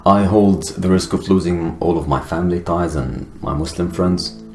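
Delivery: ordinary talk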